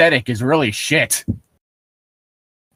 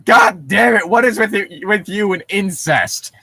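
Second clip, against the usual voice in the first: exasperated outburst; 6 semitones higher than usual. Real, not acted. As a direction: yell it in real exasperation.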